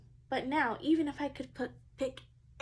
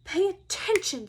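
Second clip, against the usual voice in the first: snapped, louder and higher; 4 semitones higher, 6 dB louder than usual. Acted. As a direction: snap at the pupil to pay attention. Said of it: "snaps Maestro".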